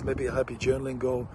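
normal talking voice

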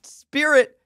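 ordinary talk

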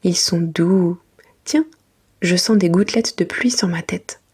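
plain reading voice

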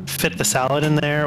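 usual speaking voice

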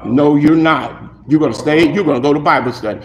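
low, firm parental correction; low voice of the Spirit, fast at the end; stern inner voice. Acted. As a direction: lay down the law from within. Holy Spirit correcting him.

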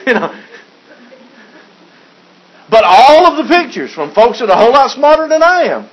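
ordinary talk, nothing marked

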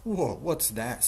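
loud, affronted surprise; startled snort. Acted. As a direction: draw it out in kingly indignation. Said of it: "snored Yertle".

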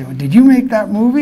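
bright kid's question, voiced up high; eager kid asking. Kids crowding him at airports.

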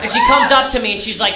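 ordinary talk, nothing marked

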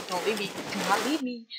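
rushed desperate appeal; urgent pleading. Acted. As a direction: plead quickly under your breath. Begging, first lower take.

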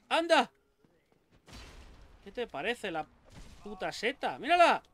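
high, loud burst of amazement at the mushroom; excited amazement. Real, not acted. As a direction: shout it out, astonished. (gritando, asombrado ante la seta gigante)

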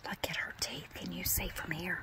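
hushed whisper aside. Real, not acted. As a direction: whisper so the caller can't hear. Whispering aside during call.

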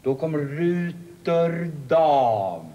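very slow, drawn-out reveal; Då kommer Ruter Dam, each word stretched very slowly. Shown as a demonstration. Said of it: "Ska jag ta lite långsammare då?".

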